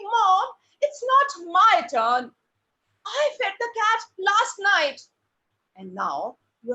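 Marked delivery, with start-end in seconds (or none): 0.0-5.0 high, drawn-out, louder plea; pleading. Shown as a demonstration. Child pleading with mom about whose turn it is. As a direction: plead with long stretched words. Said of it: "And you're pleading".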